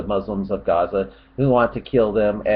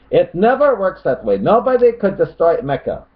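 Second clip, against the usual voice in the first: high, raised voice for a quoted objector; mock opponent voice; 7 semitones higher, 4 dB louder than usual. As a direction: read the comment out high and indignant. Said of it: "He says".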